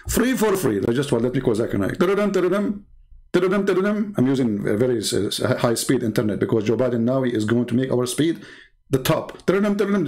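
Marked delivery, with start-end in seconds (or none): 2.0-4.1 sing-song ring tone of a phone; imitated phone ringing. Calling Zakir Naik on a pretend phone, mock ringing. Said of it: "See how fast the ringing?". 9.4-10.1 sing-song ring tone of a phone; imitated phone ringing. Calling Zakir Naik on a pretend phone, mock ringing. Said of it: "See how fast the ringing?".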